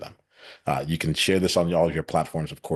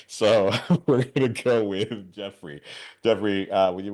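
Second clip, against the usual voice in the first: laughing; 5 semitones higher, 3 dB louder, slower than usual. Real, not acted. Laughs while handing over.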